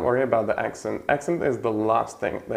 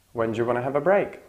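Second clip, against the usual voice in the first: fast relaxed; faster than usual. Shown as a demonstration. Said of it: "the last word is prominent".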